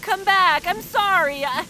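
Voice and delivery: high, loud calls after a friend leaving; calling out. Acted. Noah calling after Hatch in the rain, loud and pleading. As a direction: call him back, desperate.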